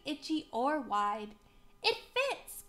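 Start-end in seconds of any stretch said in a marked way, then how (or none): 1.8-2.6 high-pitched happy exclamation; excited storybook hero, pitched high; delighted cry. A narrator's reading. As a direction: cry out at a lucky discovery. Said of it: "cried McCoy".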